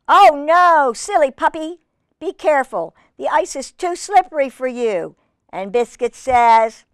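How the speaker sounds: put-on reading to children. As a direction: playfully caution a silly puppy.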